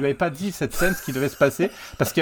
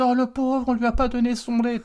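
mock pity; 10 semitones higher than usual. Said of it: "ils disent".

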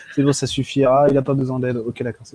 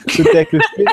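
repeated through laughter, louder; laughing; 3 semitones higher, 6 dB louder than usual. Real, not acted. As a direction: giggle through the words.

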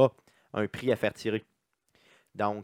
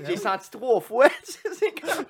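high, loud laughing speech; giggling uncontrollably; 11 semitones higher, 5 dB louder, slower than usual. Real, not acted. Laughing fit.

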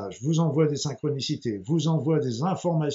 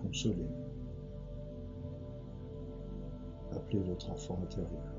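guided meditation; 5 semitones lower, 9 dB quieter than usual. Acted. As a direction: ease them gently into calm.

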